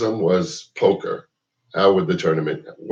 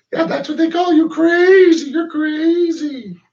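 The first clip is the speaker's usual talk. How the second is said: mock high squeaky taunt; shrill mocking falsetto; 4 dB louder, slower than usual. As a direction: sneer it up high like a heckler. Mocking what people call him.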